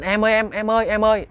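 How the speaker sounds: high-pitched urgent calls; calling out in alarm. Acted. Husband calls to his frozen wife, alarmed high call. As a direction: call urgently to a wife who isn't responding. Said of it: "Anh mới la lên".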